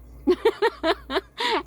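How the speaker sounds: loud, high burst of laughter; real laugh. Real, not acted. Vlogger laughing while signing off, loud high giggling burst. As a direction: crack up in a high, rapid cackle.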